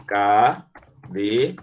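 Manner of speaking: cadeado split slowly into syllables, ca-de-a-do; teacher spells out cadeado beat by beat. Shown as a demonstration. Teacher separating syllables for the class, slowly. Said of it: "Como é que separa cadeado?".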